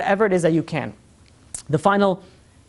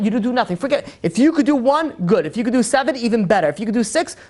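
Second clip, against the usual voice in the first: usual speaking voice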